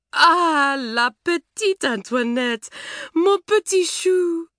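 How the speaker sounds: smother her in French endearments, crying out high; excited French cry, slow on mon petit chou; cried at the top of her voice. A narrator's reading. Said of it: "cried Mamselle at the top of her voice".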